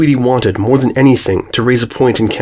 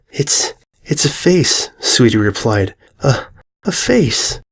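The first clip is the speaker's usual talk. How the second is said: stunned; slower than usual. Acted. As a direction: whisper the horror, stunned. Shocked filly answers.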